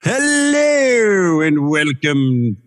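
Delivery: drawn-out, very slow club announcer welcome; strip club DJ voice. Retold or imitated. Guest greeting the host as a strip club DJ, very slow. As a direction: stretch it out like a club MC. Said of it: "that was my strip club D J voice".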